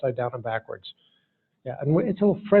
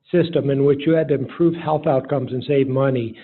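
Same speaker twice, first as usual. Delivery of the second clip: ordinary talk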